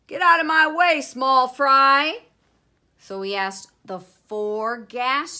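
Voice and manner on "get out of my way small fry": bossy, very loud order; Jupiter. A narrator's reading. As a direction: shove them aside with a big, high voice. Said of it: "Jupiter was too bossy".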